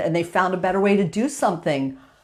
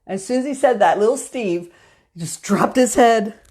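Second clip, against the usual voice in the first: usual speaking voice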